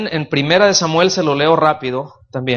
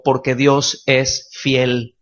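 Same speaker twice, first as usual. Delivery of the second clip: slow, lower, each word set apart for the congregation to echo; slow call to repeat; 3 semitones lower, much slower than usual. Shown as a demonstration. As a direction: lead the room in saying it slowly. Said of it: "Digan conmigo".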